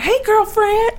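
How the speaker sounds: high, bright put-on "Hey, girlfriend"; a high mock "Hey, girlfriend"; mock gushing greeting. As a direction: mimic the people who greet her like that. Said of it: "they're like".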